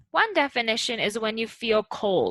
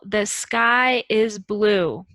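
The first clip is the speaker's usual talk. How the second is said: slow example; 4 dB louder, much slower than usual. Shown as a demonstration. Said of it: "For example".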